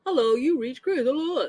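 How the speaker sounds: lightly imitated voicemail message; mock voicemail greeting. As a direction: do a bit of a recorded greeting. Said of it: "getting the fucking voicemail".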